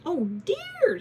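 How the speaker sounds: high, loud panicky squawk; shrill squawk of alarm; squawking hen. Acted. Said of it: "she squawked".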